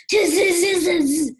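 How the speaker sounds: high, loud buzzing "tz-z-z"; monkey chatter. A narrator's reading. Monkeys mimic the scolding peddler, loud mocking chatter. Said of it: "and said".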